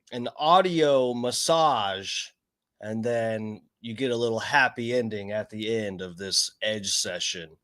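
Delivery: normal talking voice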